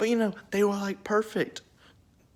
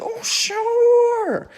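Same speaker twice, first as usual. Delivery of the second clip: put-on very high, louder squeaky girl voice for Eve; Eve's voice; 6 dB louder than usual. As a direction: chirp it way up like a carefree woman. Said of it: "And she's like".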